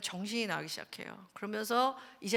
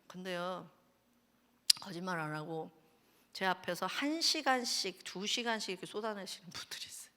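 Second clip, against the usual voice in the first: ordinary talk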